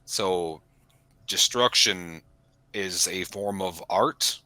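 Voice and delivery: usual speaking voice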